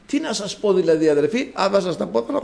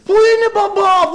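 calling out; 12 semitones higher than usual. Retold or imitated. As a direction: shout up to the child on the fourth floor. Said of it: "και εγώ να φωνάζω".

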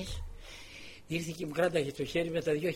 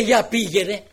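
curt loud order; 5 semitones higher, 13 dB louder than usual. Retold or imitated. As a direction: bark a loud "go on then". Official orders her off.

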